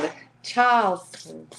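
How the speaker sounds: put-on "The Charleston" drawn out in an old Southern drawl; hammed-up Charleston drawl on "Charleston". Shown as a demonstration. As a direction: lay the Southern drawl on thick. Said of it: "Old Southern drawl".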